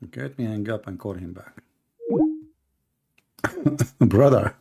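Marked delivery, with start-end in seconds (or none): 3.4-3.9 short, soft chuckle; laugh. Real, not acted. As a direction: let out a quick quiet laugh. Amused by caller saying brother.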